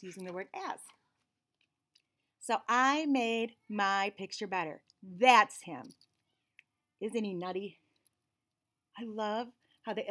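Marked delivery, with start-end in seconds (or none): 2.4-7.8 put-on lively storybook reading in a child's narrating voice; picture book read-aloud. A narrator's reading. Reading a picture book to children, lively and bright. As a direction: read it like the kid telling the story.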